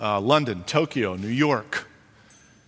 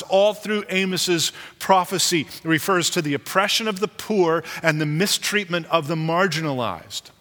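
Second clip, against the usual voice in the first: ordinary delivery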